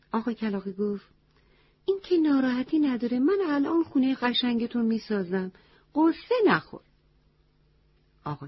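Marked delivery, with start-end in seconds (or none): 1.8-6.8 a touch higher, brisk crow comforting a friend; lightly voiced bouncy crow reassuring the elephant; cheerful crow voice.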